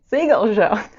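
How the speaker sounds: loud called-out echo of a friend; mock call from next room. Repeating what James calls out from the other room, loud. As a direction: shout it back like he's yelling from another room. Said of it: "I can hear James on Discord ... saying".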